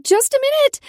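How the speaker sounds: squeaky high, loud call out; high-pitched call. Acted. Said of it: "I called in a high-pitched voice".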